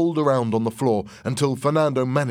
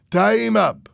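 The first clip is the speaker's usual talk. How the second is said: high, loud command; acted forceful yell; shouted order; 11 semitones higher, 8 dB louder than usual. A narrator's reading. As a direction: order it, allowing no argument. Fernando gives a harsh order.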